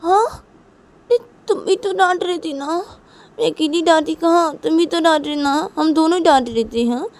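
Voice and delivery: high, defensive arguing; mother throwing the blame back at father; indignant protest. Acted. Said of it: "Ruhi बोलती कि". (तुनककर सफ़ाई देते हुए)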